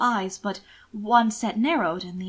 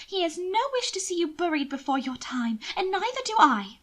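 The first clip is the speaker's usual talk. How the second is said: higher, pitying noblewoman; lightly raised lady's voice, sweetly condescending; Mina's voice; 6 semitones higher than usual. Acted. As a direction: coo over him with false pity. Narrator voices Mina.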